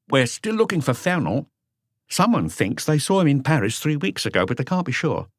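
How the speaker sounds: Lucas's lighter voice; voiced Lucas. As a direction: report it as the junior man. Lucas answers Maigret.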